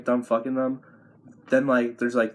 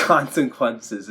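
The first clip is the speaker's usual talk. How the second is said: ordinary delivery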